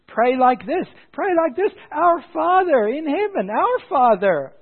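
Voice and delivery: high, loud preacher's cry; impassioned proclamation. Retold or imitated. Preacher quoting Jesus teaching followers to pray, high impassioned cry.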